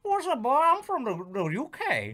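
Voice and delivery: high, loud mangled Southern put-on; mock accent. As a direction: claim a homeland in a goofy Southern accent. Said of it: "This is supposed to be northern?".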